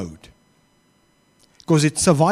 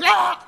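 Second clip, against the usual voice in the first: sudden high yelp of a driver; mock shout; 12 semitones higher than usual. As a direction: imitate a Tourette outburst, high pitched. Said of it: "then just shouts".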